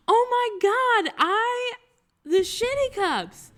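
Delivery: very high, loud fan squeal; hammed-up excited imitation; thrilled discovery. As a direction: mimic her gasping delight, slowing on the last words. Imitates fan spotting the cups.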